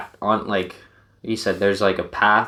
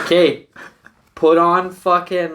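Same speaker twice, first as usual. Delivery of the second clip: much higher, louder slow command; exasperated orders; 10 semitones higher, 6 dB louder than usual. Retold or imitated. Friend telling drunk buddy what to do, loud high orders. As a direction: bark the order, fed up.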